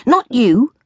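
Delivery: high, exasperated shriek. A narrator's reading. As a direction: fling it out as a frazzled correction. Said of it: "I shrieked".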